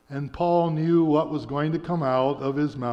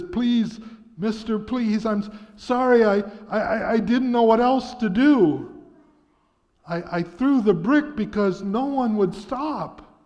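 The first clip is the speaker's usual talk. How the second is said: higher, stammering, frightened begging; shaken, apologetic plea; 7 semitones higher, 4 dB louder than usual. A narrator's reading. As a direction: plead with the angry driver, stumbling over I. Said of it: "the young boy was shaken, very apologetic".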